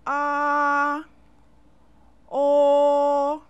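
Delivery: each vowel held high and steady, drawn out; model vowel sounds. Shown as a demonstration. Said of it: "I'll say them again".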